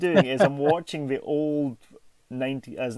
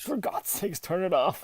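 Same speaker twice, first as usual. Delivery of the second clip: mock exasperation; 5 semitones higher, slower than usual.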